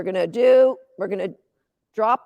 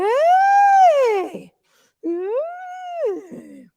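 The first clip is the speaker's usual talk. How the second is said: high squealed cheer for the dog; yay it up, way up high; 13 semitones higher, 5 dB louder than usual. Real, not acted. Handler cheering her dog after the run, squealing yay.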